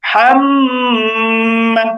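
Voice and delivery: হাম with the m held long in the nose; হাম মা, throaty h then a long hum; ghunnah. Shown as a demonstration. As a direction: stretch the nasal m of হাম before মা. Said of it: "তাহলে এটার উচ্চারণ হবে".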